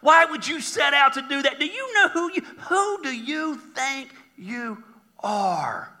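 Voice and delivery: high-pitched scornful taunting; mocking, incredulous put-down; ridiculing. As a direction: belittle them, dragging out the final words. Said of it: "they're ridiculing this".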